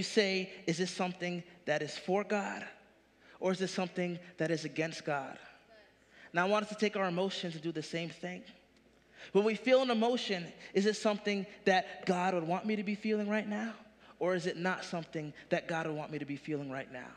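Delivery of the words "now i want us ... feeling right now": usual speaking voice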